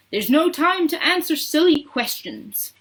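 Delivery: high, loud dismissal; impatient shout. A narrator's reading. Mister Wonka dismissing Mike's question, impatient shout. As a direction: brush the question off forcefully. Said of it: "shouted Mister Wonka".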